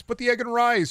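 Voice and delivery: squeaky. Pretend. Squeaky repeat.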